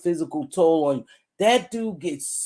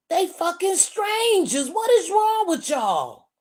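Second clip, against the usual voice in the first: higher incredulous rant at the screen; exasperated disbelief; 8 semitones higher than usual. Retold or imitated. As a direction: scold the strangers online in disbelief. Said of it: "And I'm like".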